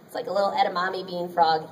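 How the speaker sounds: normal talking voice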